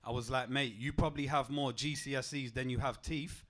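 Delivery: normal talking voice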